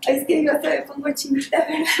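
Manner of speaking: normal talking voice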